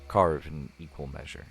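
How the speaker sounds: soft, calm scene-setting narration; story narration. Acted. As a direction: paint the campfire scene gently.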